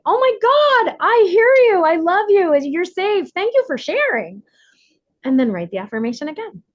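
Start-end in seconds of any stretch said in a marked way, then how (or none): 0.0-4.4 high, loud warm comforting voice; reassuring inner child. Shown as a demonstration. Speaker showing how to comfort the inner child, high loving voice. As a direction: comfort a scared child with love. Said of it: "And you can say".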